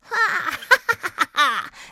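high, loud wicked laugh; long, gloating high cackle; evil witch cackle. A narrator's reading. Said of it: "lachte sie böse".

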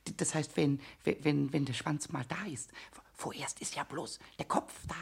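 low, soft, halting murmur; hushed watching. Acted. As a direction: keep it down so the mouse stays. Watching the mouse, not scaring it. (leise, stockend beobachtend)

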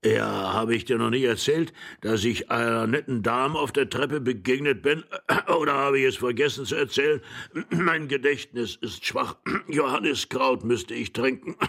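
put-on doddering old voice with throat-clearing; forgetful old man. Old husband complaining of weak memory to his wife, doddering. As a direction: ramble on, clearing the throat between phrases. Forgetful greis rambling, coughing.

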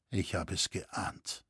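sighing resignation, barely voiced; sighed. A narrator's reading. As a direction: let it out on a heavy sigh. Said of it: "seufzte Roritzer".

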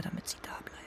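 hushed whisper, almost to herself; a guilty secret whispered. Acted. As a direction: breathe it out so no one hears. Secret hope about the sisters.

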